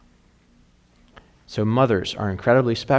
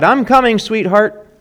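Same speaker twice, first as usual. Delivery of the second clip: much higher, louder sing-song reply; sweet mommy voice; 11 semitones higher, 8 dB louder, slower than usual. Acted. The clone answers the scared child upstairs, high and sweet. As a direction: call up the stairs like an eager mother. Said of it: "The clone replied".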